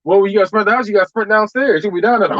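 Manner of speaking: normal talking voice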